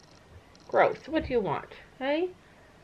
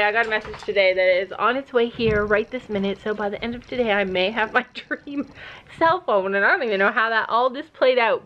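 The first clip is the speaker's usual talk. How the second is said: ordinary talk, nothing marked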